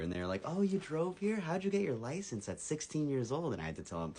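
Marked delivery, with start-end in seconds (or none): 0.4-3.5 high-pitched mock girl voice; excited girls. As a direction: imitate girls asking, pitched way up. Voicing the two impressed girls.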